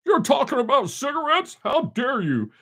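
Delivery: high, prissy scolding voice; mock an outraged censor; mock TikTok warning. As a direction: pretend shocked scold. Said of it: "watch me get a warning from TikTok now".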